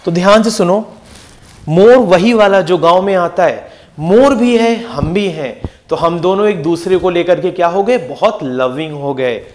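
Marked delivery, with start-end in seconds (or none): 4.0-5.6 slow memory line. Shown as a demonstration. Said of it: "याद करना है ultimately".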